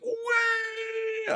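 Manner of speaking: very high drawn-out mock wail; wailing kid. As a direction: imitate a child bawling. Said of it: "even if the kid's like".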